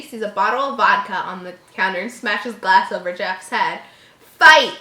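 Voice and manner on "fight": high loud shout. Acted. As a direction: yell it at him. [name] shouts.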